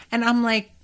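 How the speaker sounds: ordinary delivery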